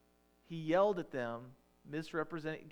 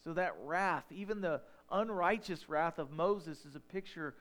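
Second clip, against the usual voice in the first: higher, louder preaching; rising preaching; 3 semitones higher, 4 dB louder than usual. Real, not acted. Preacher driving home Christ to the congregation, fervent preaching. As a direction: build toward Christ with fervor. Preaching climax, call and answer.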